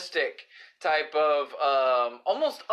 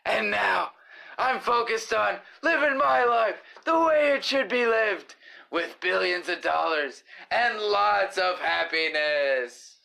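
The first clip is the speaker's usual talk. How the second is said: grand declaration, higher then slowing; Batman; 4 semitones higher than usual. Acted. Superhero explains his new life, proclaiming it theatrically. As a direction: proclaim it, stretching the final words. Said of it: "Batman, I feel like you've changed".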